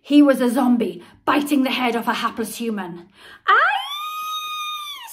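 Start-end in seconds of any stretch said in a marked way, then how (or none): 3.5-5.1 high, loud squeal of fright. A narrator's reading. Peter squeals when Henry pounces on him, high and loud. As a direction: squeal as if attacked by a zombie. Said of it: "squealed Peter".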